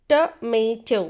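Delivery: "tomato" in syllables, tuh, MAY, toh; correct pronunciation, syllabified. Shown as a demonstration. Teacher repeating for learners, syllable-by-syllable "tomato". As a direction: break "tomato" into three separate beats. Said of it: "correct pronunciation is".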